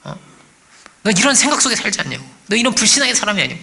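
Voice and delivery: lightly voiced devil, taunting with rhetorical questions; accusing devil. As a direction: press doubts on the listener like an accuser. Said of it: "별 얘기를 마귀가 할 것입니다".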